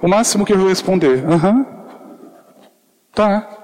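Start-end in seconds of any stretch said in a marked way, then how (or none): 1.2-3.6 timid reply. Retold or imitated. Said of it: "Para pessoas tímidas como eu".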